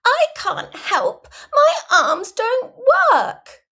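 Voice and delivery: high, loud, slow whine; hammed-up wailing complaint; child's full protest. A narrator's reading. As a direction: drag it out like a flopped-down toddler. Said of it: "flop on the floor in full protest".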